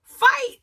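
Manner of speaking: "fight" jumps high and loud; stress on fight. Real, not acted. Vlogger mocking the word choice, "fight" squeaked high. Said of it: "skerfuffle fight".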